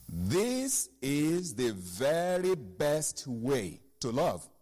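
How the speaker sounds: "This is the very best way to love" drawn out slowly, word by word; slow emphatic reading. Shown as a demonstration. Preacher reads the key verse to church, slowed right down. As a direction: let each word of "This is the very best way" land slowly. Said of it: "Pay attention".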